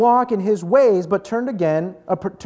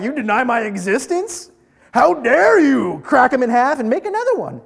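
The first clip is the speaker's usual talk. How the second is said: raised, loud indignant creator; mock outrage; 4 semitones higher, 4 dB louder than usual. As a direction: act affronted, climbing louder and higher. Acting an offended creator's outrage.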